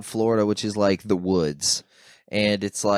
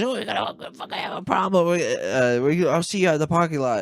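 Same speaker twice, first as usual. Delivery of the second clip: higher mock tough-guy voice; hammed-up threat; threatening heckler; 7 semitones higher than usual. Heckler threatening the comic outside, mock menace. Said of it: "And he goes".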